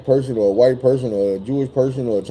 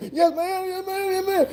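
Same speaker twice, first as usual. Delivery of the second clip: very high, much softer, near-sobbing pleas; whimpering yes ma'am; 8 dB quieter than usual. Retold or imitated. Tased man begging the officer, high tearful whimper. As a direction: beg her to stop through tears, barely audible. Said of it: "he started crying".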